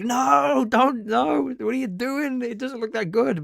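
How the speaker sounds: hammed-up cry into an online echo chamber; mock desperate shouting. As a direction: plead in a squeaky hammed-up panic. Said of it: "I was kind of shouting into a".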